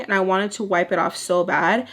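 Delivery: ordinary talk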